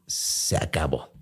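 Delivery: a breathless, defeated whisper. Retold or imitated. Telling the listener the moment he gave up, whispered. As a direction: let it slip out under the breath, giving up.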